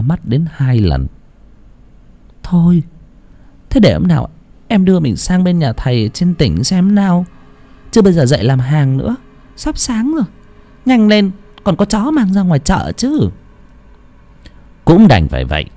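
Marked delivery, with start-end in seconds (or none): 2.4-13.3 high wife voice, practical; wife tells her husband to get up for the market, high voice; wife brisk. A narrator's reading. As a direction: hurry him up, no time to waste. Wife urges him to work.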